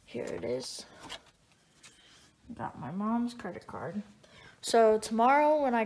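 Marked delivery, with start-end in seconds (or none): 0.0-4.0 quiet, secretive voice; hushed sneaky voice. Acted. Kid sneaking with stolen card.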